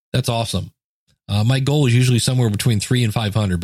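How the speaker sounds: normal talking voice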